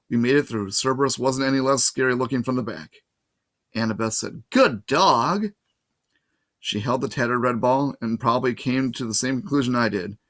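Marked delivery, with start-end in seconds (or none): 4.5-5.5 high, loud praise to a dog; bright praise. A narrator's reading. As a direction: cheer the dog on like a trainer.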